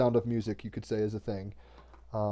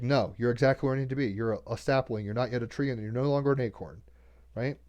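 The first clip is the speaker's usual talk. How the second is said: normal talking voice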